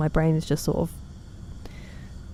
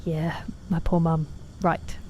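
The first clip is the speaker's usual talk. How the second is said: ordinary talk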